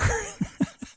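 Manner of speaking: amused high-pitched laugh; short giddy laugh. Real, not acted. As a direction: burst out laughing at the memory. Laughs at own story.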